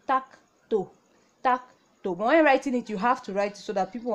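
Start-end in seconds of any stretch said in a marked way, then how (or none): 0.1-2.1 tak to spoken clearly; Korean reading. Shown as a demonstration. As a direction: model tak to for the class. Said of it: "So you pronounce".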